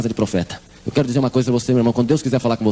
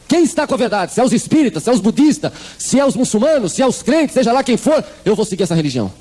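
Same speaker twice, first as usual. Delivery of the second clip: raised insistent list; 7 semitones higher than usual. Retold or imitated. Mother listing religions to God.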